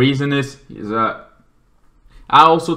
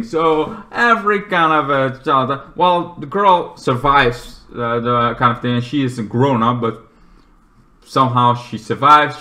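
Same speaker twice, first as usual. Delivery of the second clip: ordinary talk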